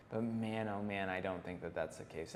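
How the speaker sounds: usual speaking voice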